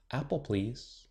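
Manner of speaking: shy, soft near-whisper. A narrator's reading. Shy girl asking teacher for juice, softly. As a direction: murmur the order timidly. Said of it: "Willow whispered".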